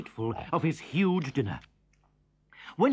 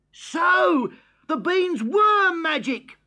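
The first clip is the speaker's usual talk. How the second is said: much higher, louder amazement; amazed shout; 13 semitones higher, 7 dB louder, slower than usual. A narrator's reading. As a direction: Jack sees the beanstalk is real. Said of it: "thought Jack".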